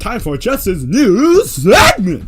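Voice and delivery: loud, stretched-out show intro; segment announcer. Pretend. Host relaunching Justin's news segment, loud hammed-up announcer. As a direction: announce it big, drawing out the words.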